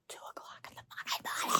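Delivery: hushed, voiceless whisper. Real, not acted. Vlogger repeating the late hour to viewers, whispered.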